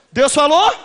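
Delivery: high, loud and rising; preacher calling out the creed; loud proclamation. Acted. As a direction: proclaim it to the crowd. Preacher leads confession.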